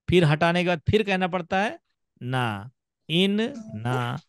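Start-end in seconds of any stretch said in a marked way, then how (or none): 2.2-4.2 इन ना broken into two slow beats with a gap; slow, the two syllables of इन ना said apart; split syllables. Shown as a demonstration. Teacher to students, showing the wrong split reading. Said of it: "एक मरतबा बोलना पड़ता है इन".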